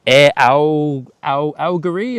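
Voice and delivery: Algerie tried in halting false starts, "Al-, Al-"; stumbling pronunciation. Real, not acted. Streamer unsure how to say the airline's name. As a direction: fumble toward Algerie. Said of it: "am I pronouncing that correctly?".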